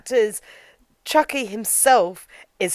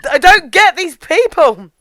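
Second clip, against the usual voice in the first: higher, much louder mock exasperation; baffled Chucky; 8 semitones higher, 10 dB louder than usual. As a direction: throw it out loudly, completely baffled. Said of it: "He's just like".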